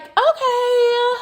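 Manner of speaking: a high, knowing mock Brittany. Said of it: "And Brittany's like".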